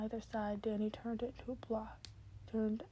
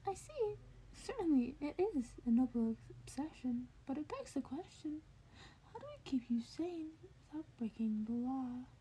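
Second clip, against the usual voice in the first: lightly raised, whispered curious principal; Nezu's voice; 5 semitones higher, 4 dB quieter than usual. Acted. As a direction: ask it softly, a little higher, like a clever small mentor. Nezu voiced by reader.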